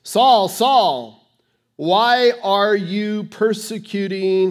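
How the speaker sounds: a booming divine call to Saul; voice of Jesus. Retold or imitated. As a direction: heavenly voice calling Saul's name. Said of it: "this is the voice".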